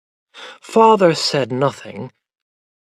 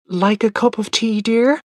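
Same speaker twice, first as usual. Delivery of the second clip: nervous; 9 semitones higher than usual. A narrator's reading. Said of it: "asked Mother nervously".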